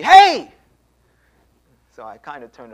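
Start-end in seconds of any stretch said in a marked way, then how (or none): 0.0-0.5 high, very loud imitated yell; screamed out. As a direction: yell after someone down the driveway. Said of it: "this woman screamed out to me".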